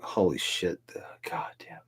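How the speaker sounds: whispered under the breath; awed whisper. Real, not acted. Realising fifteen years have passed, whispered in disbelief. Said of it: "Where did fifteen years go?".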